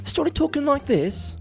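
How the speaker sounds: high, put-on British accent. Shown as a demonstration. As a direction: show off a posh-sounding British accent. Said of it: "I decided to show her my British accent".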